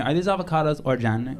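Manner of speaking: "organic" said as "or-janic"; obnoxious soft G. Acted. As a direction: ask with "organic" on a j sound. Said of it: "just be fully obnoxious".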